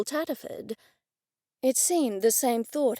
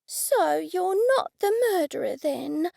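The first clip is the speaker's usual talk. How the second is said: high, let-down question; disappointed; 6 semitones higher than usual. A narrator's reading. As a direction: voice sinking with disappointment. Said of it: "disappointment evident in her voice".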